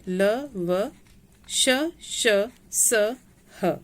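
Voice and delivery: each consonant said singly with pauses; alphabet recitation. Shown as a demonstration. Teacher saying the consonants aloud for learners, one by one. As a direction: recite letter by letter, evenly spaced. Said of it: "इन व्यंजनों को बोलकर देखते हैं".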